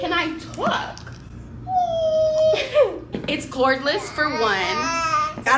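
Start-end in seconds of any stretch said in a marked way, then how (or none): 1.1-3.2 high, loud squeal of laughter; excited squealing laugh. Real, not acted. Mom squealing with laughter over her new vacuum. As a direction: burst out squealing with joy.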